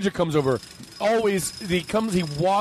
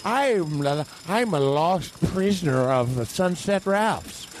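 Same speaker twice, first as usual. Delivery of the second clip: slow, theatrical lost-soul voice; lost customer; slower than usual. Acted. Lost prisoner of the supermarket introducing themselves, slow and dramatic. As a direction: announce yourself slowly, like a stranded wretch. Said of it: "I'm another customer".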